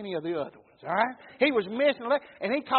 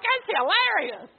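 laughing; 10 semitones higher, 4 dB louder, slower than usual. Retold or imitated. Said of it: "And I'm like".

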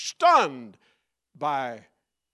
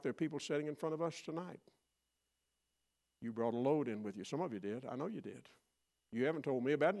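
much softer and quicker, confiding; soft, intimate aside; 8 dB quieter, faster than usual. Real, not acted. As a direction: lean in and speak gently to the flock.

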